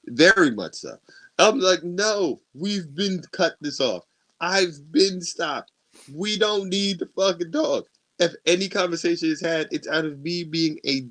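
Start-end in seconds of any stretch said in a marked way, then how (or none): 1.8-7.8 exasperated, higher-pitched insistence; losing his shit. Retold or imitated. Telling his ex it is over, exasperated. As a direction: insist firmly, pitch raised. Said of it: "lose my shit in the middle".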